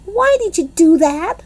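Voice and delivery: high, angry holler. A narrator's reading. Lucille hollering at the girls for chasing the boy, angry. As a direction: demand it furiously. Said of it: "she hollered".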